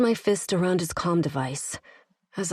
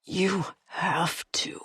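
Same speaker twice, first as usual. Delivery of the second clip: rasp. A narrator's reading. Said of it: "he rasped".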